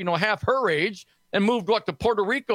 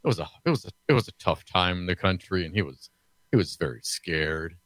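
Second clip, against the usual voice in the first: sarcastic sympathy; 13 semitones lower than usual. Pretend. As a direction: feign sorry concern, voice dropped very low. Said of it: "I'm being sarcastic".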